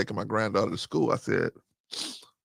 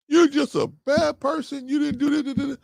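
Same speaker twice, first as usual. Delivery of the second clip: lampoon a nagging scold; husband parodying the harsh lecture he didn't give; mock browbeating.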